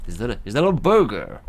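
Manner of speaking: loud, hammed-up American accent; bad American accent. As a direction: put on an unconvincing American twang, loudly. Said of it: "what kind of American accent that is".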